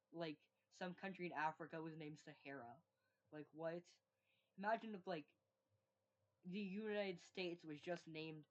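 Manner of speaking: usual speaking voice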